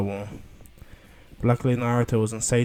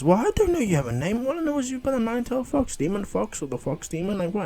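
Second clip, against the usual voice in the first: very high, squeaky-pitched protest; flustered, pitch shooting way up; Naruto; 11 semitones higher, 4 dB louder than usual. Acted. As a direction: babble back in a high, baffled voice. Acted Naruto protesting, confused.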